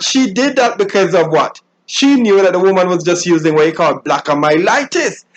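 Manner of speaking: ordinary delivery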